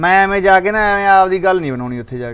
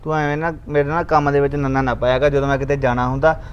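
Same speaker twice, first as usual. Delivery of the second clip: low stern warning; 5 semitones lower, 6 dB quieter than usual. Acted. Husband lectures wife, sternly.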